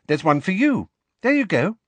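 put-on high-pitched girl character voice; Ginny, casual. A narrator's reading. Ginny handing Harry his Easter egg, casual. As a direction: lift the voice high for a friendly girl.

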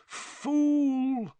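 high, drawn-out moan; pained, high-pitched wail of a word. A narrator's reading. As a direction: groan it out long and high in dismay. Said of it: "moaned Mister Weasley".

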